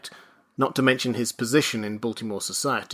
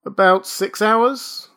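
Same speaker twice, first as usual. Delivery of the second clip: high, drawn-out reply; unthinking answer; 10 semitones higher, 6 dB louder, slower than usual. A narrator's reading. Father answers the clerk, unguarded. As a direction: answer without thinking, pitched up. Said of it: "answered Mister Button without due consideration".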